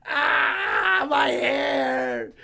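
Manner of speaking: put-on high, loud drunken cry over lost hair; hammed-up shout of my hair; anguished wail. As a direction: wail as if your hair is gone. Friend wails about his hair.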